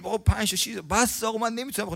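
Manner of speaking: high, soft pleading refusal; mock protest. Pilgrim refusing more food. (اعتراض ملایم و زیر زائر سیر)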